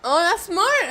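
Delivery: excited. Real, not acted. Live wrestling match reaction.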